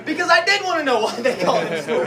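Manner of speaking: ordinary talk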